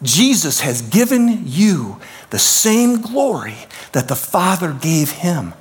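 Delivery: usual speaking voice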